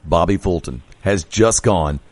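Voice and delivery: deep, measured radio host sign-off voice; radio announcer. Acted. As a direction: announce the guest like a show host, then push to the break. Host signs off to break.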